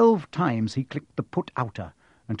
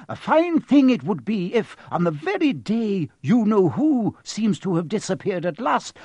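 high-pitched, emphatic, exasperated professor; indignant stern professor; 8 semitones higher, 4 dB louder than usual. Acted. McGonagall voiced in the story.

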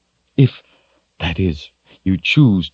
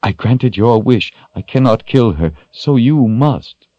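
urgent plea in a raised pitch; nearly in tears; 8 semitones higher than usual. A narrator's reading. Shota urges Richard to act, nearly in tears. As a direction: press the point, close to tears. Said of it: "nearly in tears herself".

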